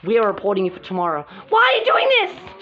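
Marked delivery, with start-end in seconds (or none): 1.5-2.6 higher upset question; high upset cry. Pretend. Doll cries out at betrayal.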